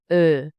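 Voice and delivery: Ö as "eh" through O-shaped lips; a long rounded "Ö". Shown as a demonstration. Teacher modelling the umlaut, rounded lips. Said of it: "round your lips into an O".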